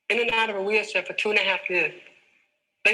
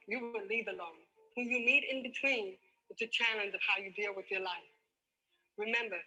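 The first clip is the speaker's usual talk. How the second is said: soft inspirational close; 7 dB quieter than usual. Acted. Soft closing life lesson.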